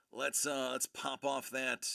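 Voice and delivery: usual speaking voice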